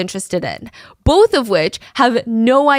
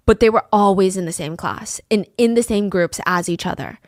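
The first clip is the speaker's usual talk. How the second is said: ordinary delivery, nothing marked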